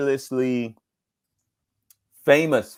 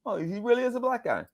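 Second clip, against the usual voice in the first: much higher voice of the realizing producer; imitating producer; 14 semitones higher, 4 dB quieter than usual. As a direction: voice her relief, high up. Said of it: "But now she knows".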